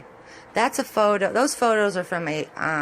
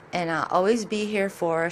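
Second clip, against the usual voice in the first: usual speaking voice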